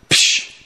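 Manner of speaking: imitated scoffing "psh"; a short, sharp burst of dismissive air. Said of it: "this was the noise that I heard".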